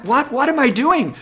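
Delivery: high, loud baffled question; mock surprise. Teacher caught singing by the class, mock surprise. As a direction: act caught out and clueless.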